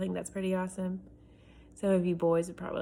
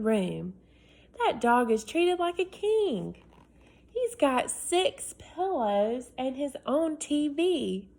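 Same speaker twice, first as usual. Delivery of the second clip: put-on higher, pleading kid voice, words stretched; eager boy; 4 semitones higher, 3 dB louder, slower than usual. Boy writing to his dad, higher and pleading. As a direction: sell it like a boy begging for a room. Reads Alex's letter aloud.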